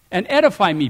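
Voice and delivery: ordinary delivery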